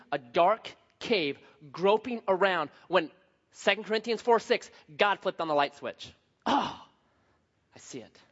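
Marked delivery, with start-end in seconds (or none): 6.4-8.2 softer, whispered discovery; awed whisper. Acted. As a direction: breathe it out as if dawning light. Preacher acts realizing the light.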